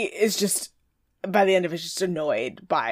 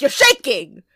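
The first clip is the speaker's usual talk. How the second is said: mock exclaim; 6 semitones higher, 7 dB louder than usual. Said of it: "Francesco's like".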